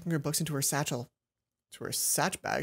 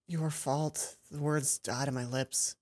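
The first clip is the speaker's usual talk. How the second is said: ordinary talk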